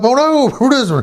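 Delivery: high-pitched startled cry; retold alarm. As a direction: imitate a startled "oh no". All jump up as dog attacks.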